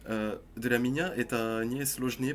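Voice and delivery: usual speaking voice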